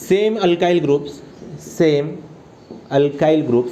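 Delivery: very slow, repeated for writing; slow dictation. Shown as a demonstration. Teacher dictating the rule's second part, slow. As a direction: give them time to copy each word. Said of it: "एक वाक्य लिहितो इथं बघा".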